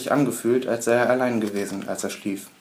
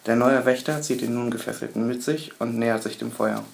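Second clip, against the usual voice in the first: ordinary delivery, nothing marked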